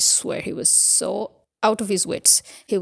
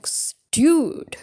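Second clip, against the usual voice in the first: incredulous dude; 7 semitones higher, 4 dB louder than usual. Retold or imitated. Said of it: "I'm like".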